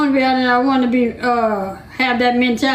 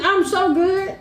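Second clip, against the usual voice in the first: usual speaking voice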